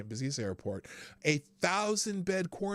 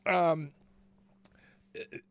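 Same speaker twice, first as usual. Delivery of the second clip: ordinary talk, nothing marked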